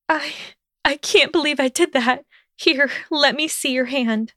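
high, halting, distressed plea; tearful-sounding high Hermione voice; stuttered sadly. A narrator's reading. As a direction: stammer it sadly, pitched high. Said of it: "she stuttered sadly".

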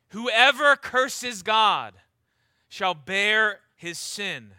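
"whoever" loud and stretched out; slow, forceful verse, stressing "whoever"; emphasis on whoever. Shown as a demonstration. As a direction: hammer "whoever", then weigh out each phrase. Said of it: "Hear the emphasis on the word whoever".

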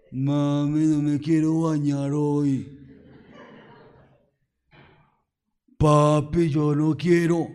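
mock child pleading with mom and dad; whiny child. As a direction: beg like a lazy kid. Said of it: "termina como".

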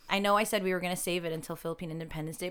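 usual speaking voice

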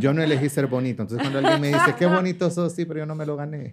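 fast, high, laughing through the words; giggling as he brushes off compliments. Real, not acted. Explaining to friend he was born pretty, laughing high.

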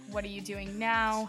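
ordinary talk

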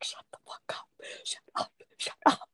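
hiss-whisper to keep someone quiet; mock whisper. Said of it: "she's like".

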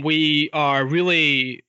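usual speaking voice

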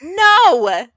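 loud, high outburst of "no"; emphatic shout. Real, not acted. Wife jokes that husbands never listen, emphatic shout. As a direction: belt it out in comic indignation.

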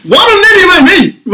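very high, loud comic wail; exasperated outcry. Acted. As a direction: cry out at the friend in mock despair. Outraged question to absent friend. (uitgeroepen in wanhopig ongeloof)

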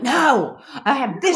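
high, loud, half-laughing insistence; mock indignant refusal. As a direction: refuse to give up the floor, playfully. Acts out refusing to stop arguing.

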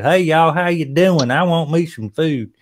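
high, loud, slow Southern drawl; slow-talking Southerner. Acted. Southerner walking into a New York store, exaggerated Southern drawl. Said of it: "slow talking Southerner".

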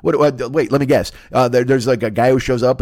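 ordinary delivery

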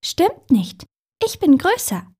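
high-pitched little puddle; tiny little voice. A narrator's reading. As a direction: pipe up cheekily from below. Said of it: "von unten ein Stimmchen".

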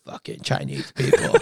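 low, whispered mock grumble; muttered aside. Americans privately mocking fooled locals, whispered aside. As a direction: drop to a whisper behind their backs.

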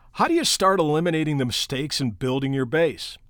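ordinary delivery

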